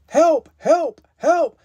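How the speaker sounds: high calls for help; little truck's cry. A narrator's reading. Little Blue Truck stuck in mud, high cry. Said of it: "cried the Little Blue Truck".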